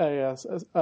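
ordinary delivery

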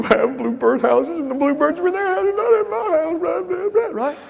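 high mock self-pity about other people's bluebirds; voicing his doubting mind. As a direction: play the nagging voice of doubt. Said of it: "notice what's going on in my mind".

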